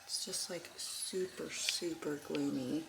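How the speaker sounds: hushed morning whisper; library whisper. Real, not acted. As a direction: keep it whispered so nobody wakes. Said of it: "I'm kind of whispering because people are still sleeping".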